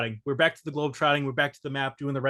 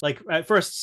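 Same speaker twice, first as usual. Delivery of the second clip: ordinary talk, nothing marked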